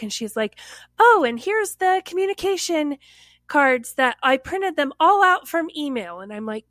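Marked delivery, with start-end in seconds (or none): 1.0-6.2 higher-pitched, breezy chatter; bubbly coworker. Retold or imitated. Coworker popping back with printed cards, bubbly. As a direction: lightly imitate a chirpy coworker bopping in. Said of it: "bopping back".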